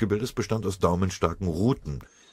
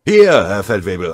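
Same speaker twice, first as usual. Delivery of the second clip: answering call; 3 semitones higher, 13 dB louder than usual. A narrator's reading.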